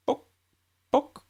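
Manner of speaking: high squeaky chicken pocks; chicken clucking. Acted. Chicken looking round the shelves, high clucking pock. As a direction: cluck like a curious hen. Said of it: "said the chicken".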